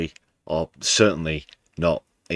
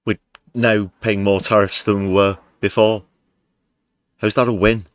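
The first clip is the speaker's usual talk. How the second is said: exasperated; 5 semitones higher, 6 dB louder than usual. Real, not acted. Exasperated rant about tariffs.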